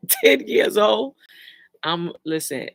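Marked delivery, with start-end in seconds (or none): 0.1-1.1 high, loud, drawn-out disbelief; loud incredulous question. Real, not acted. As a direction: ask it slowly, stunned, voice rising. Shocked at child's age.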